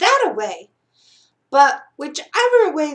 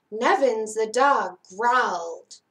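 plain reading voice

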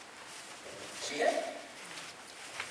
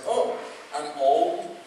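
slow deadpan joke; much slower than usual. Retold or imitated.

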